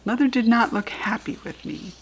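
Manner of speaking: plain reading voice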